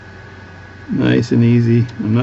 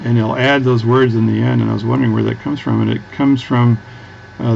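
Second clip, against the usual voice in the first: usual speaking voice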